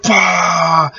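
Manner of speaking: high, punchy bah of super strength; a playful superhero blast; mock power burst. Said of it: "I was like".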